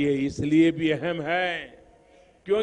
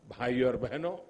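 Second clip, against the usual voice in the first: usual speaking voice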